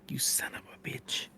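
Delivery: hissed under the breath; a quiet mocking whisper; whispered curse. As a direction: whisper the insult with relish. GM whispers curse after damage.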